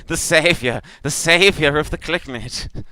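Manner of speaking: high, loud reverent proclamation; worshipful creature. Acted. Creature genuflecting to its savior, high worshipful cry. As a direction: hail them in a high creature voice. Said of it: "with all four arms and goes".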